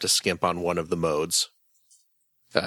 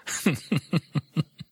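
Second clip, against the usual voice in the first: laugh; 8 semitones higher than usual. Real, not acted. Laughs after own joke.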